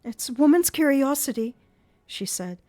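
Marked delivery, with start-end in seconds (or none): 0.0-1.5 put-on higher, lightly playful girl; forced playful. A narrator's reading. Christine to Eric, forced playful higher voice. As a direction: try to sound playful over fear. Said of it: "a tone which she tried to render playful".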